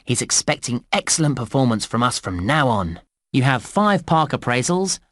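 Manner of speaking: normal talking voice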